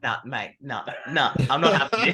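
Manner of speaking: building from muttered to loud, rising on having; a heated player refusing to back down; mock fiery outburst. Aggressive on the tennis court.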